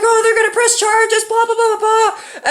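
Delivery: high, whiny mock panic; freaking-out mom. Mom panicking over pressed charges, mocking imitation. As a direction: mimic mother's fretting in a squeal, tailing into blahs. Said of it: "she's freaking out and shit".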